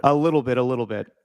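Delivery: ordinary talk, nothing marked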